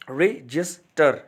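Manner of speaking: रजिस्टर slowly in three beats, re - jis - ter; split into syllables. Shown as a demonstration. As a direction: break रजिस्टर apart syllable by syllable. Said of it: "तीन syllable में वो तकसीम हो रहा है". (रजिस्टर को तीन हिस्सों में तोड़कर, धीरे-धीरे)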